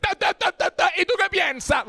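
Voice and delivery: rapid-fire, high Puerto Rican patter; Puerto Rican accent. Shown as a demonstration. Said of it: "and Puerto Rican's like".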